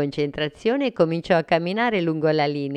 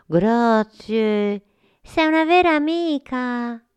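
moved monsters thanking; 8 semitones higher, 4 dB louder, slower than usual. Acted.

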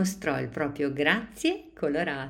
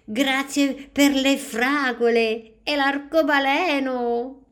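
much higher, louder, slow bright exclamation; a little girl exclaiming her thanks with joy; exclaiming child; 11 semitones higher, 7 dB louder than usual. A narrator's reading. Diana exclaims her thanks to the class, high and joyful. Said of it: "Diana esclamò".

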